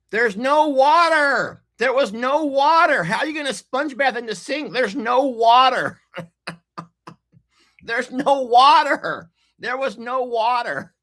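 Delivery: shrill-pitched disbelief, stretching each "water"; exasperated rant. Real, not acted. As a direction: whine it out, fed up and incredulous.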